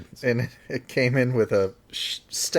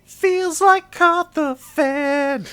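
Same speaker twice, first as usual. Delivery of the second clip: silly drawn-out voice; 9 dB louder, much slower than usual. Pretend. Silly sung-out line, laughter.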